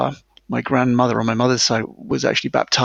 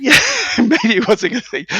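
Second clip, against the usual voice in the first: laughing, amused agreement at a higher pitch; laughing along; 11 semitones higher, 4 dB louder than usual. Real, not acted. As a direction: chuckle as you concede the point. Agreeing with interviewer's joke.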